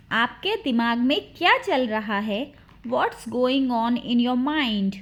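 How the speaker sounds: normal talking voice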